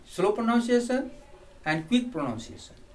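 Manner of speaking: ordinary talk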